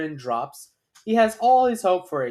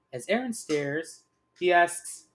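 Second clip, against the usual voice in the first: plain reading voice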